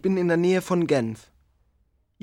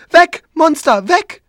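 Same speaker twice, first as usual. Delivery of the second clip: put-on screaming child; 11 semitones higher, 11 dB louder, slower than usual.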